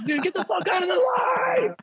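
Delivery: high mock shout at the customer; mock furious yell. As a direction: scream it like a clerk out of patience. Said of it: "zero to negative patience".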